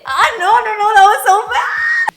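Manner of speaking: high, very loud wail of protest; embarrassed shout. Real, not acted. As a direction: cry out in mortified protest at your own attempt. Cringing at own failed accent.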